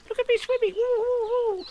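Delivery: little kangaroo showing off swimming; squeaky Roo. Acted. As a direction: squeak it with glee. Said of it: "bubbling proudly".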